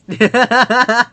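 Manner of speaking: loud, high burst of laughter; rapid cackling laugh. Real, not acted. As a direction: crack up at your own joke. Laughing at own reference.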